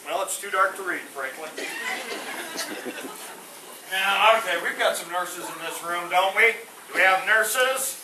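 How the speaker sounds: usual speaking voice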